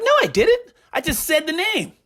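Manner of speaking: high, fast denial; quick high-pitched no; mock own denial. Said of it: "I was like".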